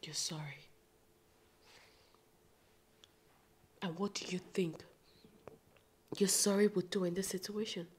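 scornful whispered retort; whispered contempt; bitter whisper. Acted. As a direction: hiss it in disbelief.

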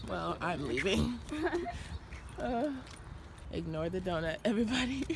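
hushed. Real, not acted. Saying goodbye to girlfriend at dawn, hushed.

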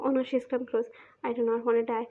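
ordinary delivery

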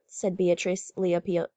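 usual speaking voice

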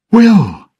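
high, loud call. Acted. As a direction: cry the name out to him. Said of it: "Elizabeth called".

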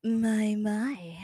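a teasing purr that fades into a whisper; flirty "my, my". Acted. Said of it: "You could try saying, "my, my" instead".

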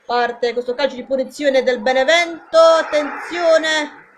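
lively play-by-play call; live football commentary. Acted. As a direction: call the match for the fans.

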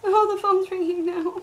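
normal talking voice

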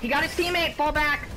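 loud alarmed yell; shouting orders over battle. Real, not acted. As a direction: yell the warning over gunfire. Teammate downed mid-fight.

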